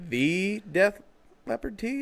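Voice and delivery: high, rising questioning echo; emphatic THE, lifting high on "tee"; mock incredulous. Shopper echoing the cashier about the tee, mock incredulous.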